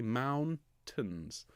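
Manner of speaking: "mountains" as MOUN, then soft tns; soft second syllable. Shown as a demonstration. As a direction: lean on moun in "mountains", ease off the ending. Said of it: "second syllable is quieter and slightly softer".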